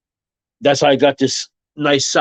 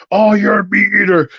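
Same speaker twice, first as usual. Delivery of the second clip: high, louder scandalized gasp; mock outraged vegan; 7 semitones higher, 3 dB louder than usual. Mocking preachy vegans, high scandalized cry. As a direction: act appalled at a meat eater. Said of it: "I don't even be like".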